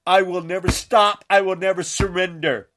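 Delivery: ordinary delivery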